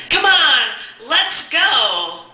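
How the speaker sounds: hurrying mom into the car with a smile; smiling, upbeat. Retold or imitated. Urging mom to drive, upbeat smiling call. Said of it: "I said with a smile on my face".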